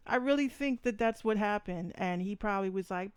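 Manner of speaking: ordinary talk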